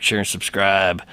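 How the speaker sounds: ordinary talk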